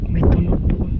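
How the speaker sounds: calling out loudly toward the noise; loud alarmed call about a sound. Real, not acted. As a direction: project it across the space.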